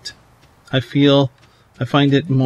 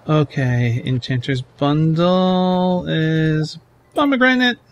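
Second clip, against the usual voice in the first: ordinary talk, nothing marked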